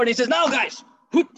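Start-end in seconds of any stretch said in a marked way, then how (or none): 0.3-0.8 higher, urgent attack cue; calling out a signal. Acted. Leader to his men at the ambush, calling out. 1.1-1.3 quick whooshed "whoop"; imitated sword swish. Storyteller miming the beheading, imitated swish.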